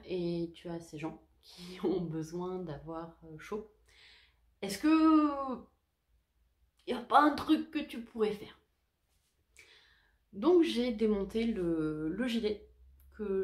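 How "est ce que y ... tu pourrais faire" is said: higher, louder nudging inner voice; a little voice in her head prodding her; the nagging conscience, raised and insistent. Retold or imitated. Inner voice questioning herself.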